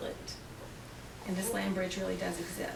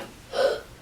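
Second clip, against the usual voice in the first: scared gasp; 3 dB louder than usual. Acted. Finn frightened.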